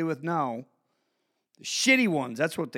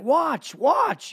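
put-on kid begging to be watched; 8 semitones higher, 4 dB louder than usual. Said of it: "they're always like".

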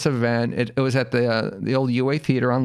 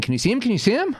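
higher, rising mock urgency; urgent parents; 12 semitones higher, faster than usual. Parents ask son in the crowd, urgent. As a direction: imitate parents eagerly checking on their kid.

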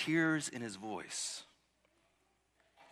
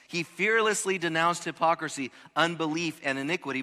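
preached quote reading; 6 semitones higher, 8 dB louder than usual. Acted. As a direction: proclaim each line to the hall. Reading quote at full force.

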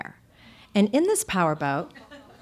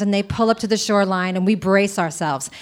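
ordinary talk

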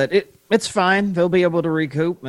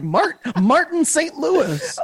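slow, higher, stumbling clueless fan voice; average Joe Schmo; 12 semitones higher, much slower than usual. Retold or imitated. Co-host mocking a casual fan saying the name, higher and slow. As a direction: say the name like a casual fan who barely knows it. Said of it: "your average Joe Schmo would totally just be like".

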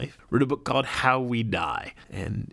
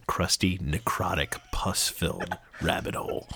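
ordinary delivery

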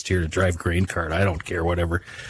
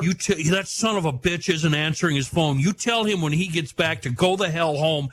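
raised pitch, irritated mock rant; angry boss; 9 semitones higher than usual. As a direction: rant like a fed-up farmer. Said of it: "He goes".

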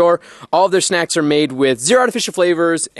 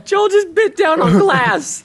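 high, slow, through helpless laughter; laugh-crying the words out; 12 semitones higher, much slower than usual. Real, not acted. Host cracking up after accident.

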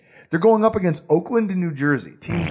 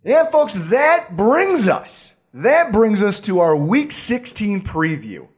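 higher, louder radio host lead-in, slowed on the first words; radio host intro; 3 semitones higher, 3 dB louder, slower than usual. Acted. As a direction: announce the segment to the folks like a radio host. Show host opens the preview segment.

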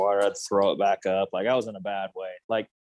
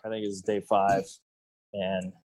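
usual speaking voice

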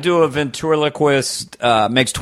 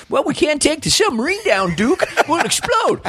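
much higher, whiny sidekick squeal; Walter Brennan; 14 semitones higher than usual. Retold or imitated. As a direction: panic high like an old sidekick. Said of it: "Walter Brennan be like".

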